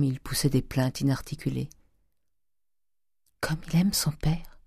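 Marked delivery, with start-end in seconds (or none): 3.4-4.6 whisper. A narrator's reading. Eugénie whispering tenderly to her mother about Charles. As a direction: breathe it to her mother so no one hears. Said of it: "dit Eugénie à voix basse".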